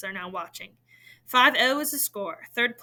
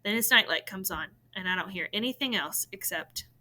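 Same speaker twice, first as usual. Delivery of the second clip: plain reading voice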